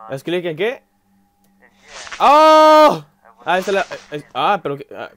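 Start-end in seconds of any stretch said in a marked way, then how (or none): 2.2-3.0 loud, high "oh!" scream; real jump-scare yelp; startled scream. Real, not acted. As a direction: cry out suddenly at a scare. Jump scare in horror game.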